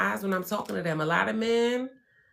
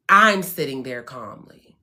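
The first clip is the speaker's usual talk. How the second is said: normal talking voice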